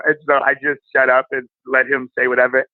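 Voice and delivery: ordinary talk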